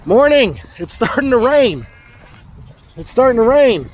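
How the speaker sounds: high calling over the rain. Real, not acted. Farmer calling to goats as rain starts. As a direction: announce the rain to the herd.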